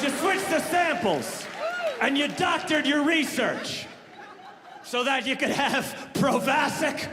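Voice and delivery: high, slow, drawn-out accusing shout; furious. Retold or imitated. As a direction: hammer out the accusation in a high, outraged yell. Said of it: "of course he's furious".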